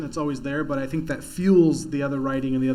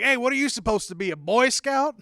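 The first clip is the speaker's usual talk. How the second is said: higher, louder mocking jeer; homeless heckler; 6 semitones higher, 4 dB louder than usual. Homeless guy heckling the comic's suit, mock jeer. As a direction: tease the suit like a street heckler. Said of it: "he's like".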